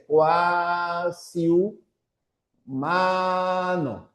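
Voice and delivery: "cuasi humano" split into slow syllables; teacher spelling out "cuasi humano" by syllables. Shown as a demonstration. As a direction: sound out "cuasi humano" in parts. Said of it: "sería así".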